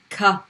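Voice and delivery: ka with mid tongue on the roof; light ka. Shown as a demonstration. Teacher demonstrates ka for students. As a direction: model the English-like ka. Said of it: "middle of the tongue".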